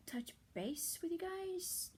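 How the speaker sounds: ordinary talk, nothing marked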